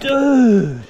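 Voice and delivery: high, loud and amazed; thrilled exclamation. Real, not acted. Angler amazed at the beautiful trout, high and loud. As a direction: blurt out pure amazement. Marvelling at caught fish.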